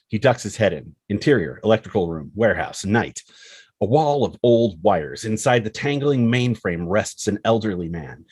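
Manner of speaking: ordinary delivery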